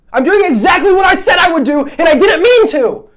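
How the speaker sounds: real heated self-reproach; big mad. Real, not acted. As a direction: burst out, mad at yourself. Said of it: "I'm big mad now".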